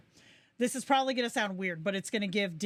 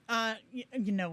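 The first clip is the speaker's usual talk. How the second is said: ordinary talk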